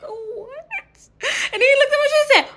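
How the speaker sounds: high laughing squeak; giggly high retelling. Real, not acted. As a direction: laugh as you tell it.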